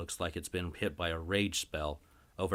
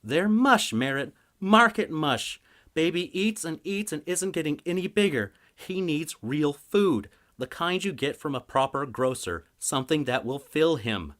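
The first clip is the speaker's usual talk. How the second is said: higher, louder and scornful; scornful wife; 10 semitones higher, 7 dB louder than usual. Acted. Wife scorning her husband's apples, high and scornful. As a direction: dismiss his apples with disgust.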